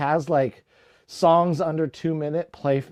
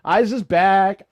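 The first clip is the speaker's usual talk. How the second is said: usual speaking voice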